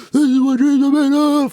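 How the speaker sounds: very high-pitched mock screech; Kevin Garnett victory screech. Friend imitating a player's famous victory moment, full high screech. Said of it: "like, victory screech".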